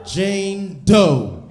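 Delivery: loud, high reveal; punchline. Acted. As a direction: slam the punchline.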